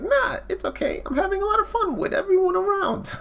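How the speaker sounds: squeaky, extremely high little creature; cartoonishly tiny sea creature. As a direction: pipe up in a teeny falsetto. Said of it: "he's got a very tiny voice".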